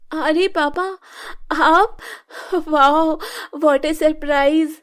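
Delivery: high, loud excited greeting; surprised delight. Acted. Sneha greeting her father at the door, high and excited. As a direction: gush with surprise.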